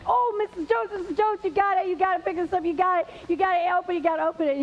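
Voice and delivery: put-on high, breathless-with-eagerness kid voice; eager little boy. Jimmy begging his teacher to open his box, excited. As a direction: urge her on in excited, repeating bursts.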